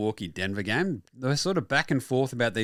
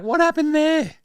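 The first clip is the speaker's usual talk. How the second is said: high, louder baffled question; mock bafflement; 14 semitones higher, 5 dB louder than usual. Fan baffled by the player's slump, high and louder. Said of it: "And you're like".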